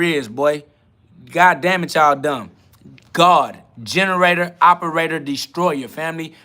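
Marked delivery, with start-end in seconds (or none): none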